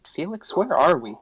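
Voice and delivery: ordinary talk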